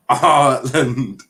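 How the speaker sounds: "Ireland" drawn out slowly; practised pronunciation. Shown as a demonstration. Streamer practises for viewers teaching him, drawn-out pronunciation. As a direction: stretch the first vowel of "Ireland". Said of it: "trying to teach me how to say Ireland".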